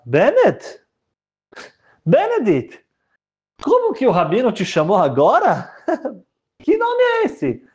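put-on high, incredulous girl's voice, ending in a laugh; a bride baffled by a strange name, pitched high; puzzled bride. Bride asking the groom about his odd name, high and bemused.

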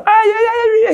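high-pitched mock wailing cry; imitating the Rebbe. Jester imitating the Rebbe at a wedding, high mock wail. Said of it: "Ele decidiu imitar o Rebbe de Satmar".

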